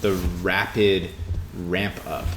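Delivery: ordinary talk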